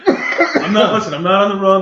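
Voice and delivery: high, loud, through laughter; real laughing protest. Real, not acted. Guest denies being on the run, laughing protest. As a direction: laugh while insisting.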